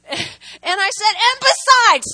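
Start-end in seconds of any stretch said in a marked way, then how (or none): 1.1-2.1 loud, high, drawn-out retort; second angry eruption. Retold or imitated. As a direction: explode afresh, punching it out. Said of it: "second, second eruption".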